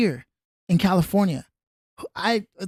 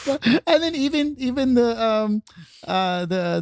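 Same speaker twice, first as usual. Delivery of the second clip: usual speaking voice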